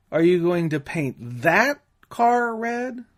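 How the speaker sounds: car stretched and lifted; stress on car. Shown as a demonstration. Instructor demonstrating emphasis on car. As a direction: ask if it's the car, not something else. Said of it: "or emphasize one word".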